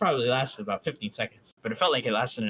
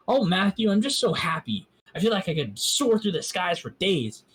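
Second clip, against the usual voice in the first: higher and louder, giddy with happiness; overjoyed; 7 semitones higher, 3 dB louder than usual. A narrator's reading. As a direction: let the joy lift every word. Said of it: "as she said that".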